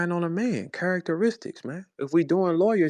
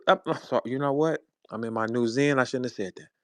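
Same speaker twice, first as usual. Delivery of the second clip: normal talking voice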